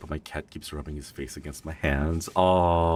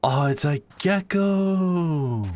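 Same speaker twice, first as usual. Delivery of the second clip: much higher, slow, louder sing-song; delighted discovery; 6 dB louder, much slower than usual. Real, not acted. Streamer finding a gecko while exploring, high delighted drawl. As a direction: draw it out in happy surprise.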